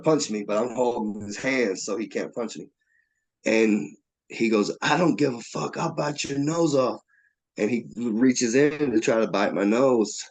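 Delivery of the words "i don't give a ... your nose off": higher-pitched retold fury; imitated defiant threat. Frog held by the wrists, imitated defiant threat. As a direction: threaten as the cornered attacker. Acted threat during the fight.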